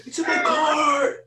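high-pitched yell, stretched out on the repeat; retold excited scream. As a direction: re-enact your own frantic screaming. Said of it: "I was screaming".

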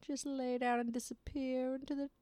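high, slow, whispery coaxing villain; seductive villain. Acted. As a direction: tempt them to lie down like a sly villain. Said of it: "It's like the villain".

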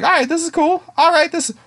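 high, light, easygoing approval; cheerfully unsuspecting. Retold or imitated. Retelling himself watching the anime, cheerfully unsuspecting. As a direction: imitate a contented viewer, pitched well up.

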